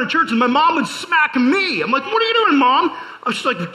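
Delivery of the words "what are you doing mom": put-on high, louder whiny kid voice; mock kid protest. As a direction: squeak it out like a wronged boy.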